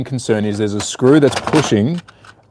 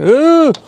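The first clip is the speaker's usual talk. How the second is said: short loud high "ugh" of annoyance; high frustrated grunt; 5 dB louder than usual. Real, not acted. Reaction while closing the case.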